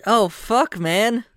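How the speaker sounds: high surprised outburst. Acted. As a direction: blurt it out, caught off guard. GM voices Fern noticing tie-dye.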